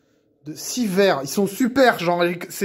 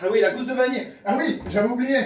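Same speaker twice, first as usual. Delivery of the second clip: ordinary delivery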